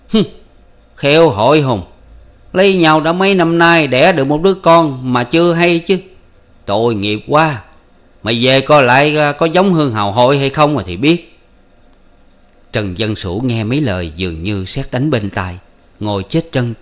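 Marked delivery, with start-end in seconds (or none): 0.1-11.4 higher, much louder, sneering character voice; mocking old man. Tam telling Sủ to check whose face his child has, mocking. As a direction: rub it in with a cruel laugh in the voice.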